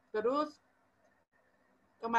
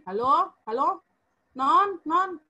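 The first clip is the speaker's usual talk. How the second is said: louder, higher calls down a phone; calling out; 5 semitones higher, 7 dB louder, slower than usual. Retold or imitated. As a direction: re-enact calling to someone who won't answer. Calling into a silent phone line.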